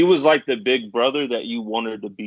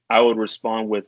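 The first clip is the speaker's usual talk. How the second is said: normal talking voice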